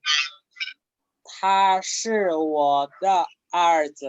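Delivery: 他是我的儿子 said very slowly, syllable by syllable; slow model sentence. Shown as a demonstration. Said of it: "Por ejemplo". (despacio, como modelo)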